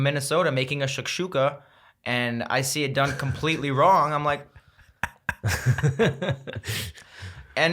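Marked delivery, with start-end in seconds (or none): 5.0-6.9 helpless chuckling in place of words; drawn-out amused laugh; reaction laugh. Retold or imitated. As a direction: laugh it off as your reaction to a botched dish. Acts out reaction to botched dish.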